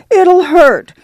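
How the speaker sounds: put-on high, fearful cry of a girl; frightened plea. Scared girl pleading to Ma before the piercing. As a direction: beg in fear before the needle. Girl fearing second ear.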